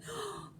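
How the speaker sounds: sharp high breathless gasp; alarmed gasp. A narrator's reading. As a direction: gasp in sudden shock. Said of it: "a sister from the convent said".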